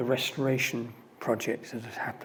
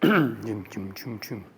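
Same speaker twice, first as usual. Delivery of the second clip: boat engine noise; 4 semitones lower, slower than usual. Retold or imitated. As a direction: imitate the boat engine chugging. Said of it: "engine noise again".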